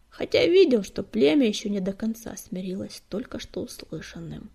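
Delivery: plain reading voice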